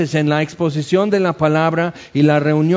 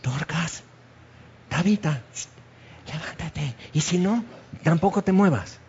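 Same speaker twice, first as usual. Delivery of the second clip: hushed, much softer, almost under the breath; very quiet; 8 dB quieter than usual. Pretend. Peter kneeling by the body, hushed and much softer. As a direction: say it barely aloud so no one hears. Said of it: "así muy bajito".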